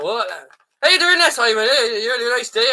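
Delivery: put-on high, loud Irish-accented babble; hammed-up drunken Irish accent; drunk Irish uncle. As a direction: imitate a tipsy Irish uncle slurring his greeting. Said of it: "drunk and he would speak quickly".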